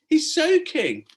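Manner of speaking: high, loud exclaiming; a girl's loud high exclamation; exclaimed. A narrator's reading. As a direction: blurt it out in surprise. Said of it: "exclaimed Stella".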